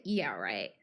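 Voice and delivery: dismissive sneer, voice dropping way down; sarcastic scoff. Retold or imitated. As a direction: scoff it off as if you saw through it all. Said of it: "I'm like".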